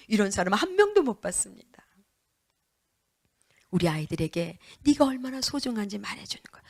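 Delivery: ordinary talk, nothing marked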